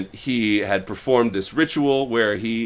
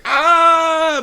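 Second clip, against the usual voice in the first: high, loud battle cry; power-up scream; 6 dB louder than usual. Retold or imitated. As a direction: shriek as the power bursts out. Rowan unleashes her power.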